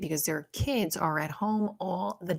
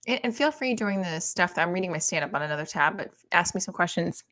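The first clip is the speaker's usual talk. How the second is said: ordinary talk, nothing marked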